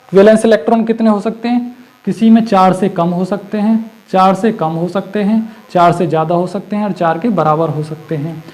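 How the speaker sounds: normal talking voice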